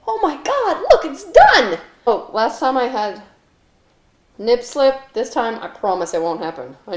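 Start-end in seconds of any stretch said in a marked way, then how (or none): 0.1-1.8 high, loud mock amazement; excited announcement. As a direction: exclaim it high, building louder. Timer goes, mock excitement.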